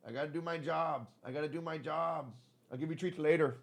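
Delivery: soft, soothing pet talk; gentle apology. Real, not acted. Owner putting off cat for work, soft soothing. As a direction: let a cat down softly.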